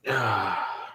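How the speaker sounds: soft, audible exhale; sigh. Real, not acted. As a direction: let out a quiet sigh. Winding down a late stream.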